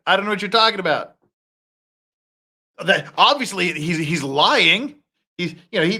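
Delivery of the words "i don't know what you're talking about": quick mock denial. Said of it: "He's like".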